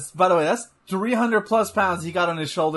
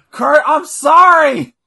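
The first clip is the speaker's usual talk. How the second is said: pleading wrestler; 8 semitones higher, 8 dB louder than usual. Pretend. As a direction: beg loudly, half sobbing. Voices wrestler apologizing to Kurt.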